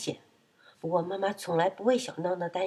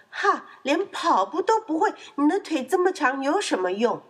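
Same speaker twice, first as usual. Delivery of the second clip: high, loud, gloating jeer; mocking laugh; 7 semitones higher, 4 dB louder than usual. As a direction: crow at a beaten rival. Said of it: "闹闹大笑说". (大笑着嘲弄)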